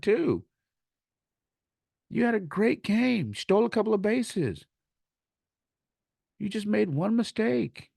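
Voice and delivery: high, emphatic coach encouragement; impassioned retold pep talk; fired-up reassurance. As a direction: push the pitch way up, insisting the player did well. Retold pep talk to crying player.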